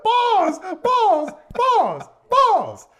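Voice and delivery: frantic high prayer of pause; desperate pleading. Real, not acted. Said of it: "I prayed".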